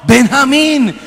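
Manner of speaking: high, loud repetition of the name; insistent answer. Retold or imitated. Possessed man repeats his name to exorcist, insistent.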